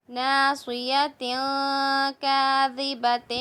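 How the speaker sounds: slow, drawn-out tajweed reading; slow recitation. Shown as a demonstration. Teacher slowly reciting the words for the pupils. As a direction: lay each syllable down slowly for the class. Recites phrase while building it.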